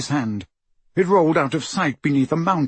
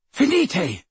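high spell cry; Harry crying out to steady the wall; cried spell; 8 semitones higher than usual. A narrator's reading.

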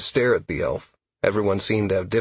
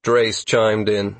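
plain reading voice

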